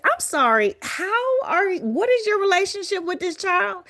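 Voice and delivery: high, pointed mock-polite challenge; doctor questioning sharply. Doctor challenging the woman with the child, high and pointed. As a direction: pitch jumps up high, pressing for an answer.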